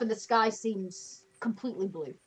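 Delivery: ordinary talk, nothing marked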